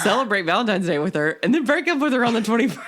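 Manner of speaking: high, laughing joke advice; giggling through it. Real, not acted.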